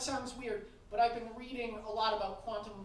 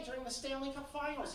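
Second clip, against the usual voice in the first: ordinary talk, nothing marked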